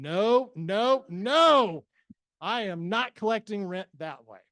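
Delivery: loud, escalating string of objections; emphatic mock refusal. Buyer objecting to collecting rent that way, loud retold protest. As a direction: belt out each refusal as if appalled, then lay down the law.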